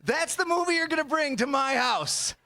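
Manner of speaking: high, drawn-up indignant yell; mock outrage. Real, not acted. Comic scolding his date over her movie pick, high outraged yell. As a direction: holler it in comic disbelief.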